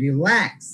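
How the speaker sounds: Relax, rising onto lakhs and falling; second-syllable stress. Shown as a demonstration. Teacher repeating a verb example, second syllable of relax stressed. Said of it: "second সিলেবলে pressure দিব".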